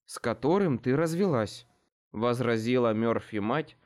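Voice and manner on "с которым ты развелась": ordinary delivery, nothing marked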